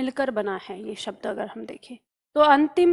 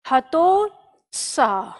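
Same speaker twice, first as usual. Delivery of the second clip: हतोत्साह stretched out slowly, piece by piece; very slow dictation; 2 semitones lower, much slower than usual. Shown as a demonstration. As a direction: spell it out slowly for writing. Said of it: "दो टुकड़ों में याद करेंगे".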